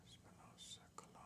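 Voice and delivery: breathy whisper read off the page; soft voiceless reading. Real, not acted. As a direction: whisper the name to yourself. Reading record names to herself.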